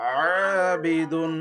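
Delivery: slow আবিদুন with a pressed, throaty আইন; teacher models the throat letter; throaty ain. Shown as a demonstration. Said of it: "normal ভাবে কেন হবে".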